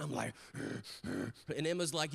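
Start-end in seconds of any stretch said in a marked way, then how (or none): 0.5-1.5 low exhausted huffing; low, effortful breath; strained grunting sigh. Retold or imitated. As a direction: huff and groan under a heavy load. Exhausted dad strains on bike.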